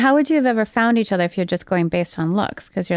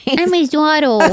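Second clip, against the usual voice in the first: put-on high, slow little-girl voice; baby voice; 9 semitones higher, 5 dB louder, slower than usual. Shown as a demonstration. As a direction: say it like a small child, giggling. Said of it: "You say it in a baby voice".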